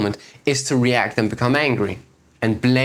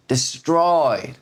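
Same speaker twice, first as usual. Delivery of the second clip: ordinary delivery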